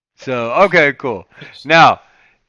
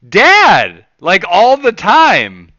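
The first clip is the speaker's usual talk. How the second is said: put-on loud, high, slow kid voice; imitating tattling kid; 5 semitones higher, 7 dB louder, much slower than usual. Max blaming Dad to mom, imitated tattling. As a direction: blurt it out like a child telling on dad. Imagined child informing on dad.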